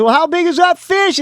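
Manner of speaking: high, loud call across the water; hailing the captain from the dock; yelling from the dock. Retold or imitated. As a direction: shout it over to the boat. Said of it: "I'm yelling at him from the dock".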